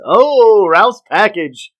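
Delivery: loud excited gasp, slowed. Real, not acted. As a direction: burst out with delight. Excited reaction to package.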